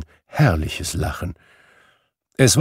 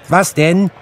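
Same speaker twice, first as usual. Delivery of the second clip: put-on boy calling back; 10 semitones higher, 7 dB louder than usual. Boy answers Scrooge.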